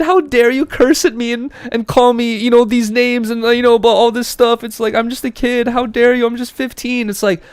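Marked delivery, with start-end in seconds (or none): 0.0-4.6 much higher, louder whiny brat voice; high, indignant brat mocking a kid who claims innocence. Mocking kid's excuse. 4.9-7.1 high, indignant brat mocking a kid who claims innocence; mocking whiny kid. Mocking kid's excuse.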